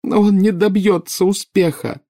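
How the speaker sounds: high, loud tearful cry; sobbing. A narrator's reading. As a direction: wail it in despair. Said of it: "рыдала Нарцисса". (рыдая)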